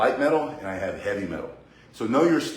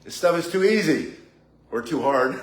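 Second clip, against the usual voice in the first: put-on higher, louder bored-kid talkback; mock student comeback; 5 semitones higher, 4 dB louder than usual. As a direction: fire it back like a cheeky student. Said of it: "It's like".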